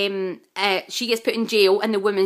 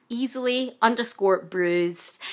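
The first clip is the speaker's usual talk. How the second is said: slow repeat; slower than usual. Shown as a demonstration. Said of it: "So that's at".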